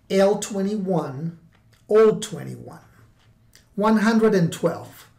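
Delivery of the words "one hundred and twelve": ordinary talk, nothing marked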